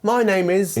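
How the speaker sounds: high, hammed-up British girl. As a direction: introduce yourself in a high-pitched British caricature. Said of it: "a British girl who then goes".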